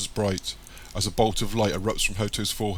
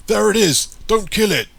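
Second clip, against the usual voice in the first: very loud, high soldier's shout; full-force raised command; 13 semitones higher, 10 dB louder than usual. A narrator's reading. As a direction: shout an urgent order across the room. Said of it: "burst in the room and shout".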